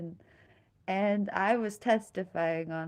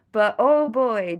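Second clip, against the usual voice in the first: ordinary talk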